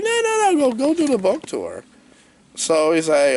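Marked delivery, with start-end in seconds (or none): 0.0-1.8 very high, fussy string of refusals; mock dismissive. Boss sends hero off on tour, mock dismissive. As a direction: wave him off as the comic boss.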